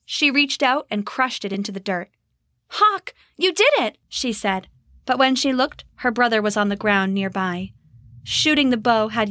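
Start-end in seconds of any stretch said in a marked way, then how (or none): 2.7-4.0 high, joyful shout; excited cry. Acted. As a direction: cheer for your brother. Rose celebrates brother's shot.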